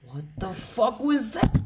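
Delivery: high-pitched and loud, voice jumping up in disbelief; screechy cry of disbelief; shocked shriek. Real, not acted. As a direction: yelp it out like you can't believe it. Shocked by another sudden hit.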